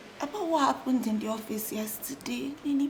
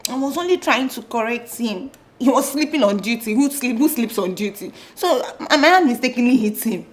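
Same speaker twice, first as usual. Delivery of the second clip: heated defence; 11 dB louder than usual. Acted. Brother defending himself heatedly.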